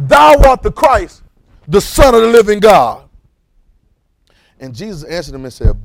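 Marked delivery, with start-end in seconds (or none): 0.1-3.1 much louder, slow, preaching shout; preacher roaring the confession; absolute proclamation. Shown as a demonstration. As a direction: thunder it out with full conviction. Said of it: "you gotta understand how he said it".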